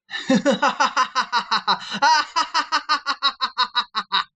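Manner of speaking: high, long evil cackle; maniacal laugh. Shown as a demonstration. Vlogger showing critics his maniacal laugh. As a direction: cackle like a mad villain. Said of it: "laugh maniacally, like this".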